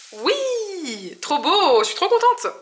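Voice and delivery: high, loud cheer of joy; thrilled squeal of success; excited. Real, not acted.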